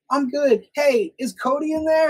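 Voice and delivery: high, called-out question; imitated friendly mom. Mom asks the visitor about her son, falsely casual. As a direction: act the mother fishing sweetly for her son.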